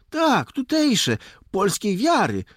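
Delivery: high, loud, slow-starting answer; eager village boy. Acted. Jakub assures the lieutenant they are locals, eager.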